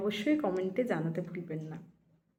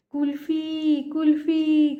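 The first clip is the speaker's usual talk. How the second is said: imitated street vendor's cry, loud and higher; kulfi hawker's call; 5 semitones higher, 6 dB louder, much slower than usual. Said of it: "তাই ডাকটা থাকতো". (ফেরিওয়ালার মতো টেনে, জোরে হাঁক)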